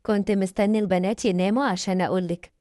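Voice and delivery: plain reading voice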